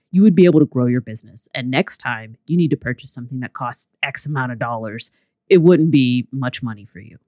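low-pitched put-on man's voice. Coach answering a price objection, in a low man voice. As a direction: audiobook narrator doing a man, pitched well down. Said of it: "I'm gonna do my man voice".

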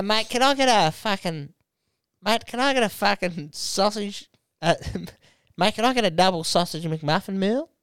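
high broad Aussie bloke ordering; broad Aussie accent. Retold or imitated. Ordering a sausage McMuffin meal in broad high Aussie. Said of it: "like a Jacques Barrett joke".

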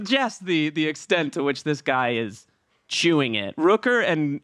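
ordinary talk

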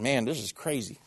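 fighting off crying mid-story; choking up. Real, not acted. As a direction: voice catching with emotion. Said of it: "I'm gonna start crying".

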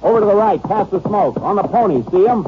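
high, urgent calls of sudden discovery; excited spotting. Acted. Deputy spotting the fleeing suspects and calling to the sheriff, excited. Spots suspects while riding.

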